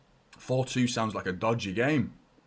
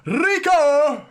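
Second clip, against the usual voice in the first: big delighted yell of the scorer's name, much higher and louder; shout the name; 12 dB louder than usual. Real, not acted. Fan watching at home yells the goalscorer's name, a big delighted shout. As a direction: roar his name as the goal sinks in.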